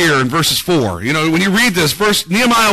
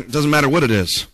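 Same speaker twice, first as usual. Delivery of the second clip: normal talking voice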